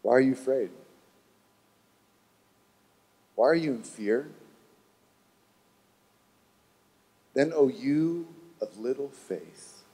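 calm Jesus. Acted. Jesus calmly questions his disciples.

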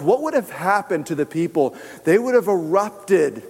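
ordinary talk, nothing marked

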